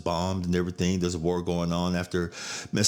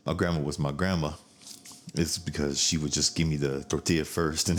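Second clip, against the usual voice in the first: normal talking voice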